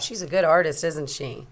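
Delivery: plain reading voice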